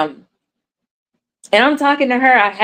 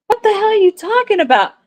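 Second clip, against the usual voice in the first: mock indignant innocence; 11 semitones higher, faster than usual. She plays dumb to husband.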